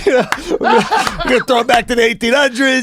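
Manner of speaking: high, loud, laughing put-on voice; imitating prison talk while laughing; laughing mock prisoner.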